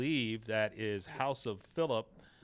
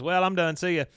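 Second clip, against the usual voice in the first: put-on a higher, louder breezy kid; mock teenage Bobby; 8 semitones higher, 7 dB louder than usual. Bobby to his family, a breezy high goodbye. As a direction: toss it off leaving the table.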